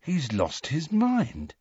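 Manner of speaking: slow, high, hushed awe; almost awed. A narrator's reading. Ron judges Hagrid's plan, in an almost awed voice. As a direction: marvel softly, drawing the words out. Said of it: "in an almost awed voice".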